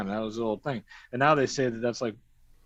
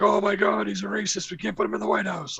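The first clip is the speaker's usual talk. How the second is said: mock outrage; 7 semitones higher than usual. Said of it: "People were like".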